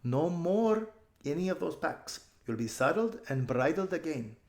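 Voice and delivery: lightly voiced girl. Aravis instructs the horses.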